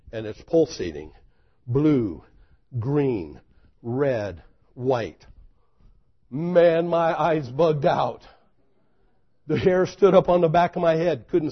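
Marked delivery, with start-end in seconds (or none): none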